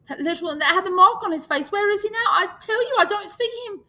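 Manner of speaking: very high, loud, panicked; desperate fear. Acted. Piggy pleading with the boys for the lost one, panicked. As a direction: cry it out desperately.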